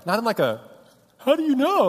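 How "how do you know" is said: high, loud panicked outburst; freaking out. Shown as a demonstration. Husband shows the reaction he didn't have, freaking out. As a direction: blurt it out in mock panic, pitch leaping. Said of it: "Like, I was freaking out".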